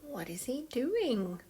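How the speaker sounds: ordinary delivery, nothing marked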